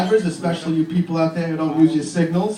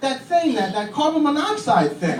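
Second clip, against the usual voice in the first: put-on child's voice; 8 semitones higher than usual. Child voice again.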